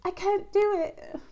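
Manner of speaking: high, put-on British accent; accidental British. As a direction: slip into a dramatic British voice. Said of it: "I turned British there".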